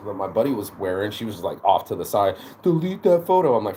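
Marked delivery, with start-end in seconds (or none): 2.6-3.6 higher, louder mock demand; imitated demand. Annoyed girl demanding a selfie be deleted, imitated bossily. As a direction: imitate a bossy complainer, pitched up.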